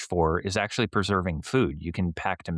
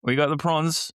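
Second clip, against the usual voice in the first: higher, louder Aussie voice; Australian accent; 6 semitones higher, 3 dB louder, faster than usual. Pretend. Keeping promise to fan, hammed Australian accent. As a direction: give it your best Aussie. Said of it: "my best Australian accent".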